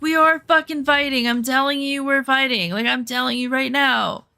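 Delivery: high, insistent mock yell, not full volume; retold yelling. Woman insisting to boyfriend they are fighting, retold yell. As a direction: re-enact yelling at a partner, pitch up. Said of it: "now I'm yelling".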